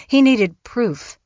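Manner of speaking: ordinary delivery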